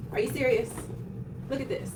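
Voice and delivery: normal talking voice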